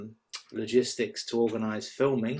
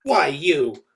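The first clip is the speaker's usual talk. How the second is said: louder, playful; mock threat; 3 semitones higher, 7 dB louder than usual. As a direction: playfully threaten to get her back. Mock threat before splash.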